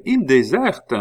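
high, loud indignant outburst; crying out. A narrator's reading. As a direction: fling it out as an accusation. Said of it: "s'écria la reine".

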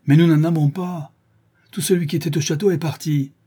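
high, forceful denial; loud protest. A narrator's reading. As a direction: insist over the angry crowd. Il proteste face à l'émeute. (protestation véhémente)

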